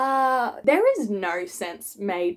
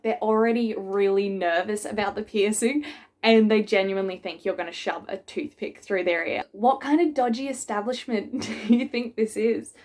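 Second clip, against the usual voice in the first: usual speaking voice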